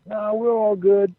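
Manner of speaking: slow, high drawn-out brush-off; imitated veterans' reassurance. Veterans reassure the politicking wrestler, slow high imitated voice. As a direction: imitate old hands waving it away. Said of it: "so they're like".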